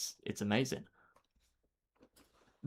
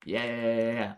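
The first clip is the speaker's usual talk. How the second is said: usual speaking voice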